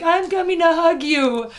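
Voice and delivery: high, drawn-out call of concern; eager comforting call. Retold or imitated. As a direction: re-enact rushing over to comfort someone. Stranger racing over to hug her.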